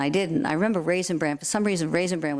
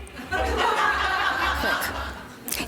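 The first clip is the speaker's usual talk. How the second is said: high laugh. Real, not acted. Reacting to own punchline.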